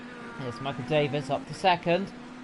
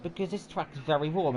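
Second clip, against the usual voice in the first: ordinary talk, nothing marked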